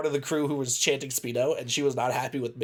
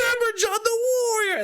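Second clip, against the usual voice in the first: mock screaming. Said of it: "is screaming it".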